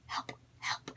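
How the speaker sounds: breathless whisper, almost mouthed; whispered plea. Retold or imitated. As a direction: secretly beg for help in a whisper. Silently pleading to other car.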